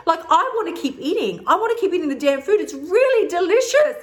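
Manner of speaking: high-pitched, exaggerated complaint; mock pissed-off protest. Complaining to the body for getting full, mock pissed-off. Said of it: "I get really pissed off at my body".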